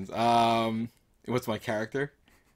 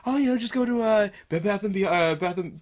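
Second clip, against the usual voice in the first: high mock voice; lightly voiced dopey suburban guy; a hint of a cheerful movie dad; movie character quote; 10 semitones higher, 4 dB louder than usual. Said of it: "that whole joke, like".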